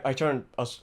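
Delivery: ordinary talk, nothing marked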